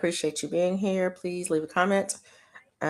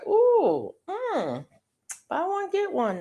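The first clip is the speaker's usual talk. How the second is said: high-pitched cooing over a bargain; tempted shopper; 14 semitones higher, slower than usual. Retold or imitated. Shopper spotting a deal in the store, high tempted cooing. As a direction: play up being tempted, pitched way up. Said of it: "I would see something and go".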